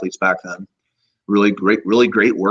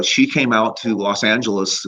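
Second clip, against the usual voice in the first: ordinary talk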